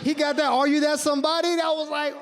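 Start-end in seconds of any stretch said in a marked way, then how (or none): 0.5-1.6 hyped shout. Retold or imitated. Calling out song title.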